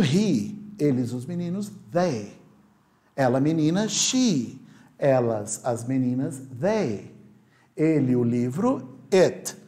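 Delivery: usual speaking voice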